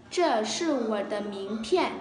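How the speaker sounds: ordinary delivery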